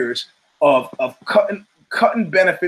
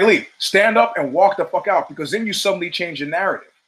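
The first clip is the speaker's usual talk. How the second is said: ordinary talk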